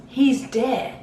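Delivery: restrained first go at a mimicked cry; retold announcement. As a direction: lightly imitate a man breaking bad news. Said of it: "this guy looks at him, and he goes".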